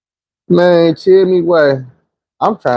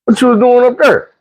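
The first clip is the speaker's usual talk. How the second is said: higher questioning coach; 9 semitones higher than usual. Retold or imitated. Coach questions the player, higher and pointed. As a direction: grill him like a suspicious coach. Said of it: "He like".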